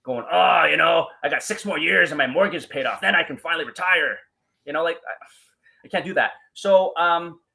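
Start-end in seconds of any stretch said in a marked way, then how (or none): 0.3-4.2 imitated bitching and griping; bitter complaining. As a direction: gripe loudly like a jaded colleague. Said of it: "bitter and angry ... bitching and complaining".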